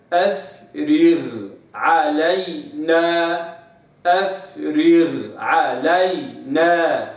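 very slow, أفرغ علينا with the غ cleanly held before ع; ghain kept apart from ain. Shown as a demonstration. Teacher demonstrating, slow clean ghain before ain. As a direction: separate غ from ع, no blending. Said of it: "فاقرأ قوله تعالى".

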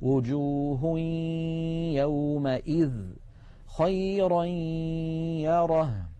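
slow tajweed chant, tanween of وجوه hummed into the ي of يومئذ; partial idgham recitation. Shown as a demonstration. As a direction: stretch خيرا into يره on one long level nasal note. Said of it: "هذه أمثلة الإدغام عندما يكون ناقصا".

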